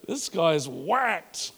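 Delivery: high mocking woo then a slow "this guy's whacked". Congregation scoffing at the pastor, high woo then slow dismissal. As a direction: scoff at a crazy preacher. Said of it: "you'd be like".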